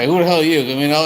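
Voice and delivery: usual speaking voice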